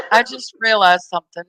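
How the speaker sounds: ordinary talk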